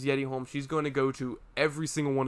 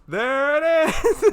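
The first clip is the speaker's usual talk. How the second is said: slow, drawn-out, higher and louder; Dave's punchline; 12 semitones higher, 7 dB louder, much slower than usual. Retold or imitated. Retelling Dave's line to a friend, drawn out. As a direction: stretch it out like the song hook. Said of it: "It's like".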